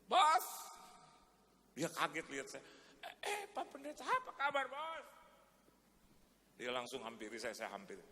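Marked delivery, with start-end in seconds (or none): 0.1-0.5 loud high call of "Bos!" again; shouted greeting. Shown as a demonstration. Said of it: "Berat itu ngomong begitu". 3.0-5.1 high, surprised voice of the boss; a caught-off-guard hello; startled greeting. Retold or imitated.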